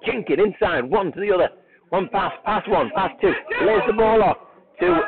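keen live football call; excited play-by-play. Real, not acted. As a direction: follow each pass with rising excitement.

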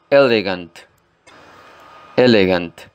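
elegant with the weight on E; stress on first syllable. Shown as a demonstration. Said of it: "hacer fuerza sobre la primera sílaba". (acento en la primera sílaba de elegant)

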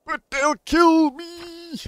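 high, loud, drawn-out wail; hammed-up cry of fear; terrified priest.

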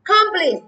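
Complete high and loud, falling on PLETE; model for repeating. Shown as a demonstration. Teacher leading the class to read along, high emphatic model. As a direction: call out Complete, weight on the second syllable. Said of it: "tất cả đọc theo nào".

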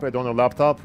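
ordinary talk

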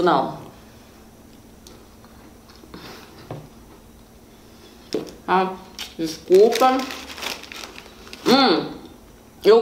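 talking while chewing; muffled through food; mouth full of food. Real, not acted. Seen 3: Bites pastry at 0.5, cheeks bulge chewing through 3.4.

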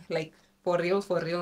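ordinary talk

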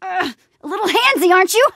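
high, strained quip; effortful banter mid-struggle; struggling. Acted. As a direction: quip while fighting off a grab. Grappled by an attacker.

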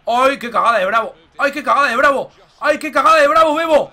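climbing disbelieving shout; building excitement. Real, not acted. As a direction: build toward the goal. Keeper blunder, goal chance.

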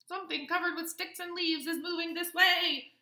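high, loud alarmed squirrel; crying squirrel. A narrator's reading. Squirrel warns of the thing approaching, high cry of alarm. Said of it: "cried a squirrel".

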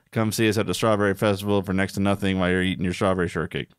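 fast, hammed-up commercial pitch; mock ad read. Pitching a festival ad to her partner, hammed-up. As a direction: sell it like a radio spot.

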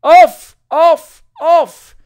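very loud, high jeering shouts; repeated high crowd yells; cried out. A narrator's reading. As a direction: shout the singer off the stage. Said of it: "cried the rest of the audience".

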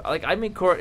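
ordinary talk, nothing marked

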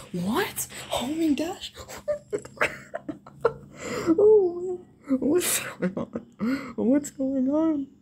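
high, slow, disbelieving laugh-cry; shocked laughter. Real, not acted. Shocked by enemy move.

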